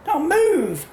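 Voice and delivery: put-on impatient old lady barking an order; bossy shout. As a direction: shove him aside with your voice. Ordering him out of the way.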